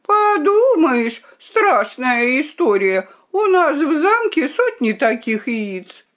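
slow, high, scornful drawl; haughty dismissal. A narrator's reading. The princess boasts of hundreds of eggs, haughtily. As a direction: shrug it off with drawn-out disdain.

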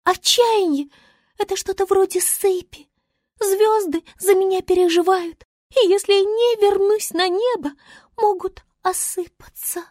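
заметно выше, капризно-важно; звёздочка поучает зверей; голос Звезды. A narrator's reading. Звезда объясняет. (Звезда важно объясняет, высокий голос)

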